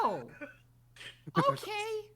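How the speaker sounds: put-on high, vague old lady voice; dotty absent-minded old lady. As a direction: accept it dreamily and wander off. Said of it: "And she leaves the bar".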